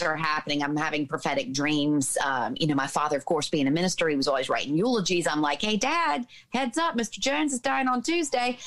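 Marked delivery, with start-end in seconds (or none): 5.6-8.6 put-on high, casual kid calling to Dad; high-pitched offhand warning, playing her younger self; chirpy child self. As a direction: imitate a child's matter-of-fact heads-up, pitched way up.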